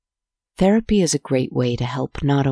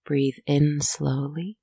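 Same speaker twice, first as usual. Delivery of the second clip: soft spa whisper; 3 dB quieter than usual. Acted. Leads a breathing pause.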